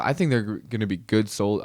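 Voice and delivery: ordinary talk, nothing marked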